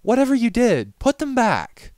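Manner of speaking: very high, loud frantic plea; panicked pleading. A narrator's reading. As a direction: near a scream, begging.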